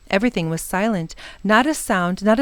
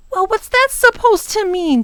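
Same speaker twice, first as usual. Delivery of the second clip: put-on high, raised boy's voice; shouted question; 12 semitones higher, 4 dB louder than usual. A narrator's reading. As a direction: shout it over the noise. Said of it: "shouted Ben".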